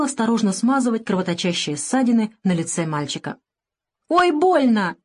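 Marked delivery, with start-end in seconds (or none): 4.1-5.0 put-on high, drawn-out child's wail; whimpering lament. A narrator's reading. Boy complains as the doctor cleans his grazes, wailing. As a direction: wail like a small boy being stung. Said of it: "запричитал тот".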